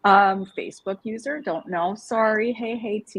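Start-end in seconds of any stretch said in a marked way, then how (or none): none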